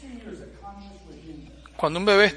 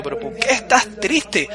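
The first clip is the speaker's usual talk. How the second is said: puzzled question; 6 semitones higher, slower than usual. Retold or imitated. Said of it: "Yo le pregunto".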